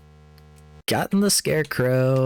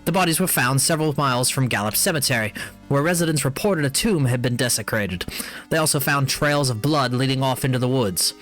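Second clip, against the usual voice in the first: news read; 4 semitones higher than usual. Acted. Reads in-game newspaper article.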